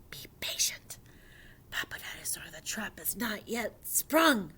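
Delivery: hushed whispered reprimand; urgent whisper. A narrator's reading. Mama Dinosaur shushing Papa in hiding, whispered. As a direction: whisper it so prey won't hear.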